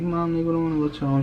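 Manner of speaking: lightly mock a frightened kid pleading; imitated scared kids. Scared kids begging mom after the call, high rushed plea. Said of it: "we're, like, crying, we're mad scared".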